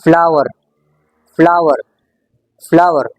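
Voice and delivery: "फ्लावर" in American English, final r strongly pronounced; American rhotic "फ्लावर" with a falling tune; American accent. Shown as a demonstration. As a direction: say "फ्लावर" the American way, hitting the r at the end. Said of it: "और American English में".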